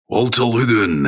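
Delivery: put-on voice sliding into Scots; accidental Scottish accent. Host impersonating an undead creature, accent slipping into Scots. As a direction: call the name in a botched accent that comes out Scottish. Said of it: "how would it sound if he was to do it".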